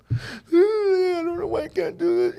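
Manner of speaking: high, drawn-out imitated wail; mock crying. Son alone in the bathroom, imitated high crying. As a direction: wail it like a crying kid, very slowly. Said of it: "I can hear him crying".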